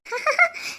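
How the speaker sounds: a short bright giggle. Acted. Sister giggling while teasing her brother, playful.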